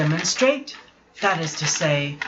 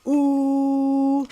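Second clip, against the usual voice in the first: high owl ooh; 8 semitones higher than usual. Shown as a demonstration. Little pig trying out an owl's ooh, high. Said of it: "You mean like this?".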